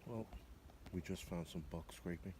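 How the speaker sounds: hushed whisper so nothing spooks. Real, not acted. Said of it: "sorry for whispering".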